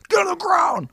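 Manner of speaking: high, strained mock attacker shout; shouted order. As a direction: bark it like a raiding soldier. Acted British attackers shouting orders.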